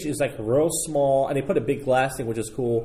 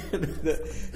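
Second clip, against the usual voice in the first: laughing; 2 semitones higher, 7 dB quieter than usual. Real, not acted. Laughing after accent bit.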